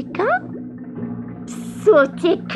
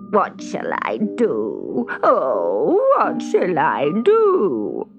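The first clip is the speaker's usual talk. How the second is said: slow, drawn-out wailing despair; sobbing witch; much slower than usual. A narrator's reading. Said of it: "she sobbed over and over again".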